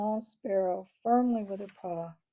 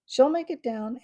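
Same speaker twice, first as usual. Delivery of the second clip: loud raised call; yelled; 5 semitones higher, 9 dB louder than usual. Acted. Ambrose Spike reassures the crowd, loud yell. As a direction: yell it with confidence. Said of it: "Ambrose Spike yelled".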